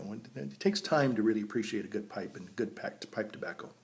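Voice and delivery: ordinary talk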